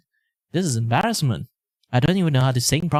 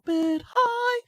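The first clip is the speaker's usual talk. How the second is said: very high voice. Shown as a demonstration. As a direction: jump way up into a far higher, thin voice. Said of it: "try to raise my pitch".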